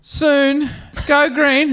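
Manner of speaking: high, light soothing voice, lifting on green; breezy reassurance. Retold or imitated. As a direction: calmly promise the light will change. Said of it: "He's like".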